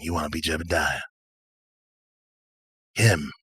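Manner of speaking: low, unhurried, knowing drifter; laid-back mind reader. Acted. Patch reads the strangers' minds, laid-back and knowing. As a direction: read them coolly, with long pauses.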